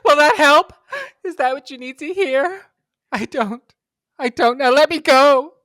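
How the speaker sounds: very high, loud overwrought outburst; dramatic heated fight. Acted. Student breaking away from a lover, loud and dramatic. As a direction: plead loudly like a soap heroine. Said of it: "dramatically act this out for you".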